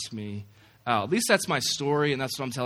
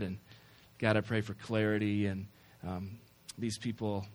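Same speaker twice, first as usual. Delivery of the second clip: soft, hushed prayer voice; 4 semitones lower, 4 dB quieter than usual. Acted. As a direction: pray quietly and reverently, with long pauses. Prays over the congregation.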